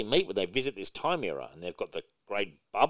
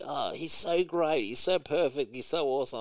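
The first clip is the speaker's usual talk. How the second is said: mock gushing; 4 semitones higher than usual. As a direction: sneer while pretending to adore. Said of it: "we're all supposed to love him".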